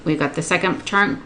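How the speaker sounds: ordinary delivery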